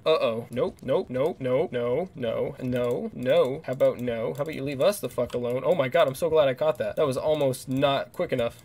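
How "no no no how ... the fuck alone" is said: normal talking voice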